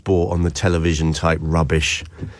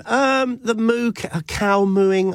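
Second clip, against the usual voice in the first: mock moo; 13 semitones higher, slower than usual. Host mimicking a cow's moo.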